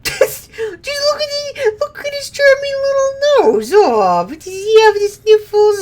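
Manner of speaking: put-on very high cooing baby-talk voice. Player cooing over the monster's little nose, high baby talk. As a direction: coo at it like a cute pet.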